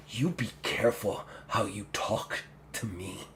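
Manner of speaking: low, soft menacing orc; hushed, controlled menace; quiet threat. Acted. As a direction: warn her under your breath. Orc's quiet threat after her gasp.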